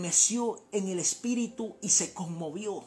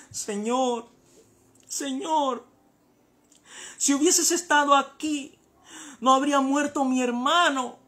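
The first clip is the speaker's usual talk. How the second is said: higher-pitched grieving plea; acted tearful lament; 7 semitones higher, 6 dB louder than usual. Preacher voicing Mary weeping at Jesus' feet, acted lament. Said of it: "Ella lloraba, lloraba, lloraba".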